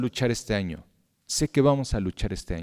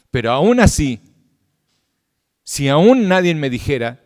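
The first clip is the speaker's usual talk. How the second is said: rising preaching; 4 semitones higher, 7 dB louder than usual. Acted. Preaching build-up.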